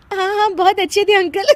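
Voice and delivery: high giggly agreement breaking down; condolence through laughter. Acted. Daughter-in-law consoling widow, laughing helplessly. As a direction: try to console, then crack up. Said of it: "हंस मत।".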